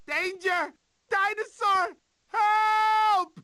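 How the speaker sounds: high, loud cry for help; panicked cry. Acted. Sid running from a dinosaur, crying for help. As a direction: scream for help in terror.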